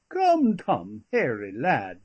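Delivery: put-on jolly it along with a touch of broad country accent. A narrator's reading. Master rousing his downcast brother, in a broad boyhood accent. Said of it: "with a broad accent".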